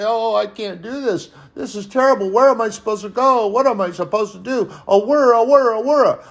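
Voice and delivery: high, loud mock whine; hammed-up frightened whining. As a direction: whimper like a scared believer. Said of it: "we whine and cry and cower".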